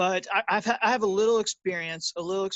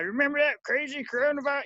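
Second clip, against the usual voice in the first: put-on high, fast old-timer voice; old man voice; 8 semitones higher, faster than usual. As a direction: ask it like a grandpa looking back. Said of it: "I need to work on my old man voice".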